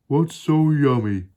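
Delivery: high, loud hippo voice; Humpy Rumpy. Acted. Hippo asking the crocodile, high and loud. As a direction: ask loudly in a high hippo voice. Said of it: "asked Humpy Rumpy".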